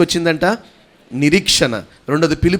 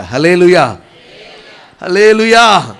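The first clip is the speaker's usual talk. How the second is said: loud hallelujah, rising on the repeat; rallying praise shout; 3 semitones higher, 8 dB louder, slower than usual. Real, not acted. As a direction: call the congregation to answer in praise. Calls congregation to praise. (గట్టిగా హల్లెలూయా నినాదం)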